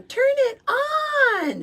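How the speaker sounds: put-on high, slow, crying child's shriek; screaming toddler. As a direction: wail it like a furious little kid. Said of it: "I'd be screaming".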